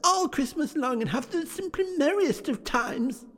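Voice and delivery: put-on high, eager child voice; little Fran telling her brother the good news, high and excited; little girl. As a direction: chirp it like an excited little sister. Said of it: "said the child".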